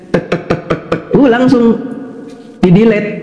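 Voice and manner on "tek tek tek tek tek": short, soft keyboard taps; typing sound imitation. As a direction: tap out typing with your voice. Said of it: "saya ngetik". (menirukan bunyi mengetik)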